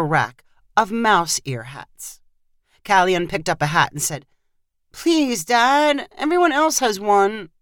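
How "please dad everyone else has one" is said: put-on high begging kid voice; child wheedling for a treat; pleading child. A narrator's reading. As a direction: beg Dad, pitched up and insistent. Child begs father for a hat.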